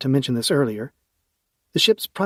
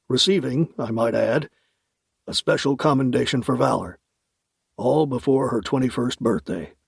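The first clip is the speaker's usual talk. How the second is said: put-on amused older uncle; lightly voiced fond uncle; uncle Max voice.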